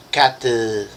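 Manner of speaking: "cat, uh" as one word, an uh tacked onto the t; added final vowel. Shown as a demonstration. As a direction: say "cat, uh" run together as cat-uh. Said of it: "you will say".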